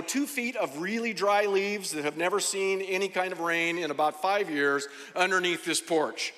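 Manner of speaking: normal talking voice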